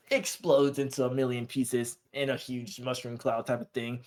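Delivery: ordinary talk, nothing marked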